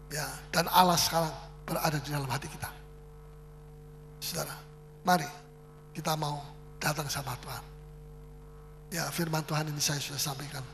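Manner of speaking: hushed closing prayer invitation; soft altar call. Acted. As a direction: gently call the congregation to Jesus.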